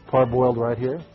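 ordinary delivery

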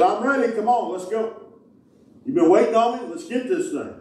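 high, eager invitation; excited call. Acted. Lord voiced calling to supper.

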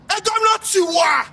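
high mocking call of a hanger-on. Mocking the governor's hangers-on, high mock call.

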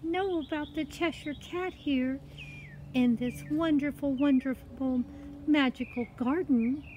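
put-on high, wondering girl's voice; innocent story girl. Girl marvels to the cat at the garden, wondering. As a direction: speak high, full of polite wonder.

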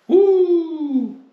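high loud whoop; excited woo. Real, not acted. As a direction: let out a big happy woo. Excited outburst after long day.

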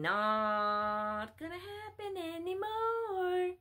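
every word stretched long on a high held pitch; slow, high, almost chanted "Not gonna happen anymore"; drawn-out refusal. Real, not acted. Preacher refusing followers' wishes, exaggerated drawn-out refusal.

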